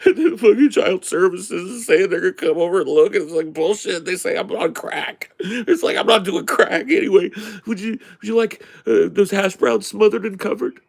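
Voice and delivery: high mock-tearful rant; whiny babbling. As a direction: babble grievances as a crying waitress taking orders. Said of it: "She was just babbling her shit".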